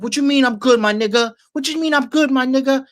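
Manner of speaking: high-pitched mock indignation; agitated comeback. Agitated man challenging the friend who checked on him, high-pitched mock outrage.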